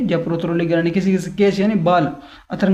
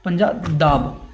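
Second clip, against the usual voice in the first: drawn-out word; much slower than usual. Shown as a demonstration. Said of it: "पंजा, हाथ का पंजा".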